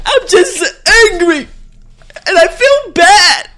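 high, loud mock sobbing through drawn-out words; tearful acting. Acting out Hen's breakdown for her co-host, hammed-up tearful wail. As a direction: wail it slowly as a hammed-up crying scene. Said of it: "I see them tears coming out".

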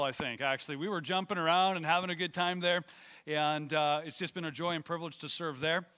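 ordinary talk

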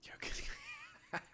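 whispered through a laugh; breathy giggling half-whisper. Retold or imitated. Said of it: "laughing whisper".

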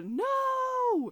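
high, loud anguished cry; a short mock wail of anguish; cry of pain. Elena crying out in pain over her brother. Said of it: "cry of pain that comes out of Elena".

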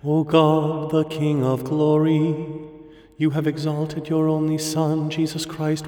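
ordinary talk

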